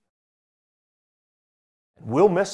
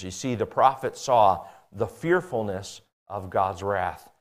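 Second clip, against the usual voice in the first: normal talking voice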